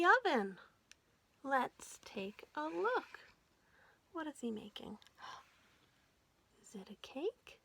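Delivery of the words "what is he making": plain reading voice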